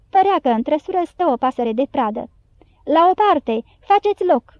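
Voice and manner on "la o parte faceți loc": mild narrated shout, higher in pitch; guards shouting. A narrator's reading. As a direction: lightly voice guards clearing the way. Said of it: "strigau cei din gardă".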